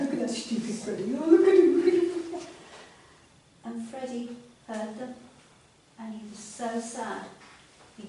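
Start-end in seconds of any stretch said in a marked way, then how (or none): 0.0-2.5 loud jeering taunt; mocking frogs. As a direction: sneer at him like schoolyard bullies. Frogs jeer at Freddy.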